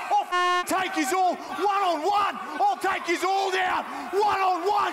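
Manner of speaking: very high screamed threat; mock furious challenge. Prank caller to rioting crowd, high screaming challenge. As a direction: scream at a mob to fight you.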